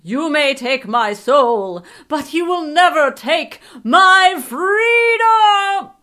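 slow heroic battle cry, building very high; screaming. A narrator's reading. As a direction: roar it, stretching the last word. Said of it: "screamed in a hundred thousand tongues".

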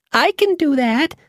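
high eager mouse voice; squeaky mouse. Acted. Mouse Bobbin promising the witch, squeaky. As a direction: chirp it out quickly to please. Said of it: "Bobbin said".

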